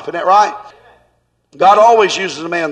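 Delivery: ordinary talk, nothing marked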